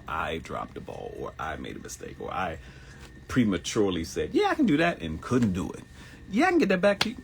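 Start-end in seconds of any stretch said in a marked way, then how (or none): 4.3-5.0 high, louder breezy yes; mock eager promise. Himself agreeing too fast to a request, high mock voice. Mocking his own promises. 6.3-7.2 high, louder breezy yes; mock eager promise. Himself agreeing too fast to a request, high mock voice. Mocking his own promises.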